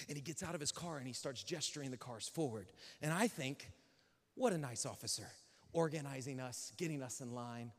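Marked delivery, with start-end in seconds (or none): 4.4-7.7 soft, low naive musing; naive admiration. Retold or imitated. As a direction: quietly admire him, lower and softer.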